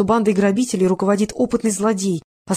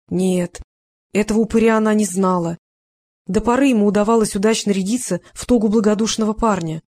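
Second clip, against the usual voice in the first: usual speaking voice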